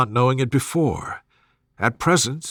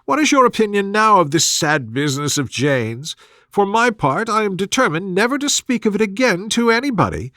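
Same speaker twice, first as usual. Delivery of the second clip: higher, fretful matron voice; fussy mother; 6 semitones higher than usual. Acted. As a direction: play the gossiping mother, higher. Mrs Bennet voiced in dialogue.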